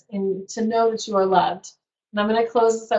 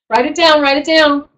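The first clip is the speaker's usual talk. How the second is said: excited urging; 4 semitones higher, 6 dB louder than usual. Real, not acted. Urging viewers to note code.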